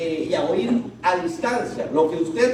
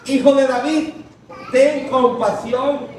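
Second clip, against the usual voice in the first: blind man crying out; 7 semitones higher, 4 dB louder than usual. Acted. Blind beggar shouting to Jesus, pleading loud cry. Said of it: "comenzó a gritar".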